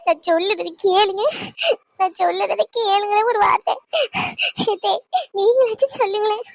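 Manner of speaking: tearful plea, voice catching on the words; pleading in tears. Acted. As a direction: beg them to listen through tears. Daughter-in-law begging amid quarrel. (அழுதுகொண்டே கெஞ்சுதல்)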